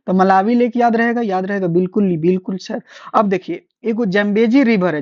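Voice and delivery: usual speaking voice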